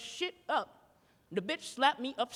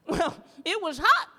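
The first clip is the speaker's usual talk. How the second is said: usual speaking voice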